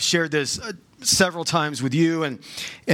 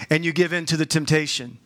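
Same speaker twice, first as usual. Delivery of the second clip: usual speaking voice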